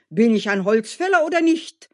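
proud indignation. Acted. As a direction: demand recognition of your strength. Woodcutter insists on his worth. (stolz und empört, laut)

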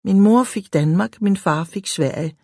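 ordinary delivery

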